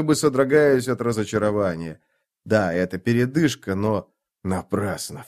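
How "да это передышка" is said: plain reading voice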